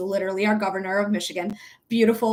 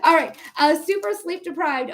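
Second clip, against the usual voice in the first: usual speaking voice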